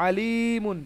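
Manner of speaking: আলিমুন with the "ii" held long; ya madd. Shown as a demonstration. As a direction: stretch the middle vowel of আলিমুন. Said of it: "এটা ইয়া মাদ্দা".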